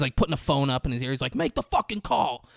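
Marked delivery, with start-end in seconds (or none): 1.4-2.4 high, raised-voice imitation of a furious order; mock screaming. Father puts a phone to his ear, high mock scream. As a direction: bark it like an enraged movie dad. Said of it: "Bruce Willis is screaming at him".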